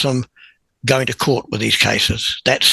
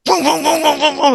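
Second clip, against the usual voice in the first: imitated button presses; 14 semitones higher than usual. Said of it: "And he just went".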